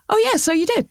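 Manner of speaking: high, sudden penny-drop voice; shop bloke realising. Retold or imitated. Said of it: "he went".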